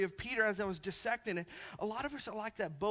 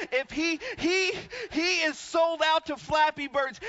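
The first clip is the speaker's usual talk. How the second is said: raised, loud, driving sermon voice; full-force preaching; 6 semitones higher, 5 dB louder than usual. Acted. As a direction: build to a shouted appeal to the congregation.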